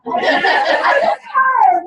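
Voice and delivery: loud giggly excuse; laughing. Real, not acted. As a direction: shout it out while laughing. Laughing excuse after joke.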